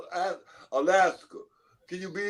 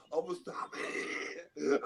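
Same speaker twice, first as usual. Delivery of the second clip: slow, soft, fluisterend mock vow; mock confession; 9 dB quieter, slower than usual. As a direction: dictate it as the line they should say. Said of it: "Say right now".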